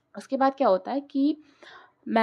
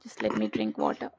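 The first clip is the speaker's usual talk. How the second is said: tired, hoarse aside; 13 semitones lower, 8 dB quieter, much slower than usual. Real, not acted. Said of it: "चिल्ला चिल्ला के मेरा गला फट गया".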